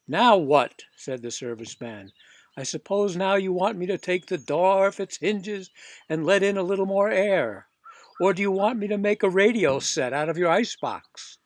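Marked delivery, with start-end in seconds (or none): 0.1-0.8 much louder, fed-up now what; a workman at the end of his patience; loud fed-up serviceman; exasperated outburst. Acted. Serviceman exasperated by more requests. 2.6-11.4 louder, mocking workman; a gruff repairman poking fun; sarcastic serviceman.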